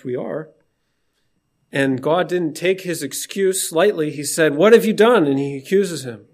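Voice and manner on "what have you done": loud accusing voice of God; booming divine accusation; stern rebuke. Acted. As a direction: demand it like an angry judge.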